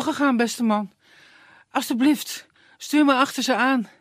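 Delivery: put-on high, loud and urgent girl; breathless young woman. Tearful girl begging the woodsman, high urgent voice. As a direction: pant it out, desperate. Elena pleading.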